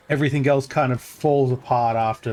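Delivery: ordinary talk, nothing marked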